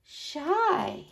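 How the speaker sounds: ordinary delivery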